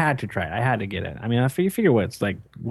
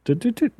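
toot sounds; 13 semitones higher than usual. Retold or imitated. Imitating phone beeps.

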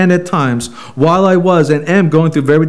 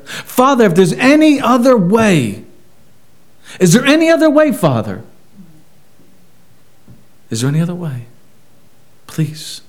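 anguished pleading prayer; 4 semitones higher than usual. Retold or imitated. Jesus praying in Gethsemane.

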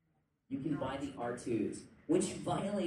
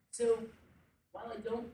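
speaking through hiccups; words jolted by a hiccup; 9 semitones higher than usual. Real, not acted. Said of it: "now I've got the hiccups".

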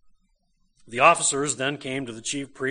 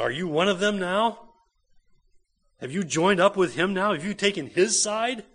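mocking, accusing questions pushed out louder; scornful accusation; 5 semitones higher, 5 dB louder than usual. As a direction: sneer at him as a traitor. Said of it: "Their scorn at him".

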